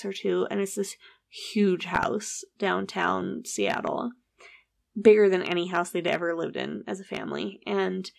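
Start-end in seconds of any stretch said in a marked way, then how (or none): none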